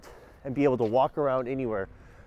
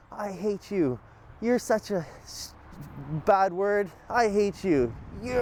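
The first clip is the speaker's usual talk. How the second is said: mock cheerful, a little higher; mean words said happily; 6 semitones higher, slower than usual. Retelling a video guy talking to his dog, mock happy tone. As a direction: say insults like sweet nothings, lightly imitated. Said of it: "saying it in a really happy way".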